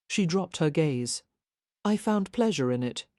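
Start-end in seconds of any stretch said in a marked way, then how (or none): none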